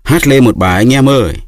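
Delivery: man on the cart calling out to all. A narrator's reading. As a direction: rouse everyone to sing. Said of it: "Một người đứng trên xe hô lên".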